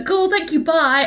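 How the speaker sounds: high, hurried mock panic; a flustered maid getting rid of him fast; flustered brush-off. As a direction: squeak out a flustered goodbye. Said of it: "She can't say anything except for".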